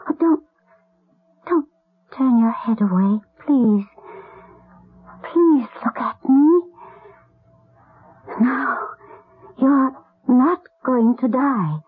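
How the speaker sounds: sobbing, desperate begging; distraught, pleading through tears; crying plea. Acted. Pleading with dying boy.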